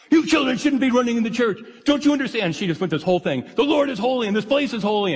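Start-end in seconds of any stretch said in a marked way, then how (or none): 0.1-2.5 high-pitched imitation of a scolding church lady; mock her shrill scolding of the children, pitched way up. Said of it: "she reamed them good". 3.6-5.1 high, preachy imitation of the scolding lady; scolding church lady. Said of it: "she just chewed them out good".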